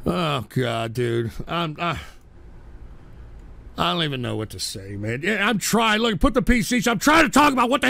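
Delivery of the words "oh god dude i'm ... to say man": low, soft and drained; deflated mumble after an outburst; weary despair. Real, not acted. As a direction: trail off, at a loss.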